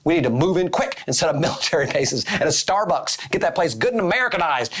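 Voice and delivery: ordinary delivery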